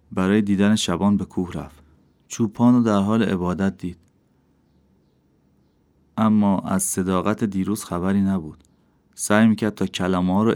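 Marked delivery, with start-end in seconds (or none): none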